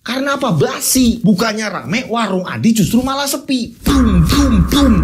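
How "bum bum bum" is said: booming drumbeat sounds; louder drumbeat noises for suspense; mock drum beats. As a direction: beat it out like a drum.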